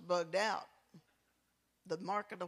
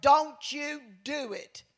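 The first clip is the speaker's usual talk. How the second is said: slow, higher, louder stern mother's warning; Mama's warning; 5 semitones higher, 7 dB louder, slower than usual. Retold or imitated. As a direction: warn him like a strict mama. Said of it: "And she said".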